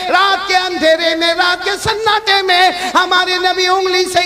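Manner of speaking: loud, rousing preacher leading the crowd; full-force sermon chant; call-and-response preaching. Acted. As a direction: drive the crowd to answer back.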